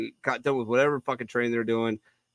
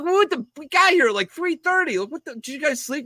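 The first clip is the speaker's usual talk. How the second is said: incredulous; 14 semitones higher than usual. Retold or imitated. Incredulous at morning drinking.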